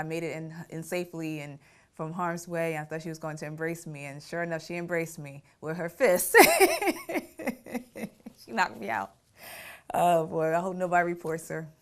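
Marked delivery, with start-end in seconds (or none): 6.4-8.4 high loud laugh bursting out; burst of laughter. Real, not acted. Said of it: "I can laugh now".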